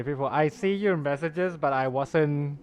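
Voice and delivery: normal talking voice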